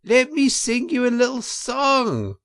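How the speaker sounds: high, loud after-dinner weasel; a pompous weasel addressing the banquet; weasel speaker. A narrator's reading. Weasel toasting the crowd at the banquet, high and loud. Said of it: "went on the voice".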